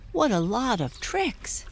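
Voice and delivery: high amazed pony voice; amazed cry. Acted. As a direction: marvel like a young pony. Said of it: "cried Tinkle".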